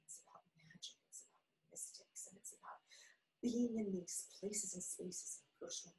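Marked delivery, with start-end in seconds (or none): 0.1-2.8 hushed. Real, not acted. Mystical meaning.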